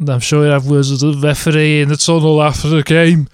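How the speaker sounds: northern accent, hammed-up; northern pundit voice. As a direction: play a gruff northern pundit. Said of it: "stop doing that voice".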